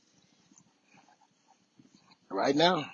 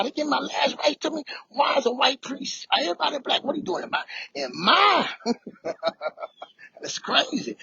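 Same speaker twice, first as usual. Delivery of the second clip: much higher, strained frantic voice; comic panicked squeak; 12 semitones higher than usual. Retold or imitated. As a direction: act out the terror for laughs. Comic panic at last rites.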